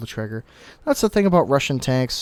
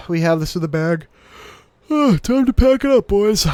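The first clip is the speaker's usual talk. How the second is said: cocky sigh of a player sure the game is won; smug premature victory; 7 semitones higher, 7 dB louder than usual. Real, not acted. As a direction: stretch it out like the win is already in the bag. Premature victory call to team.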